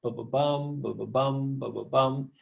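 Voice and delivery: two light beats then a stressed BUM in each "ba-ba-bum"; galloping rhythm. Shown as a demonstration. Teacher imitating a galloping horse for anapests, galloping rhythm. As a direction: gallop through "ba-ba-bum" with the weight on "bum". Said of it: "Sort of like a galloping horse".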